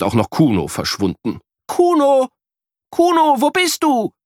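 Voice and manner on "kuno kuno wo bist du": high, louder calling for a lost friend. A narrator's reading. Boy calls for his vanished friend, calling out. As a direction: call out searching.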